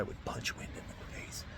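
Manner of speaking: breathy whisper, words dropping away. Real, not acted. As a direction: mutter the punchline under your breath. Venting at the storm in tent.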